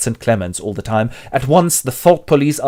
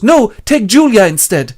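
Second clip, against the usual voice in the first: much higher, louder plea; desperate betrayal; 10 semitones higher, 9 dB louder than usual. Acted. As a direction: beg in panic. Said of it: "and goes".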